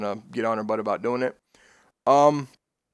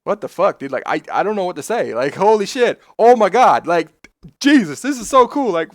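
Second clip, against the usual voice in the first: imitating a friend's stunned excitement, high and loud; retold amazed freak-out; 8 semitones higher, 7 dB louder than usual. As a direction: act out his gobsmacked freak-out, voice jumping up. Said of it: "Phil's just like; freaking the fuck out".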